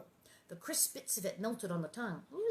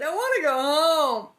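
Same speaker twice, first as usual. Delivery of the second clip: crying; 7 semitones higher, 13 dB louder than usual. A narrator's reading. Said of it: "She said".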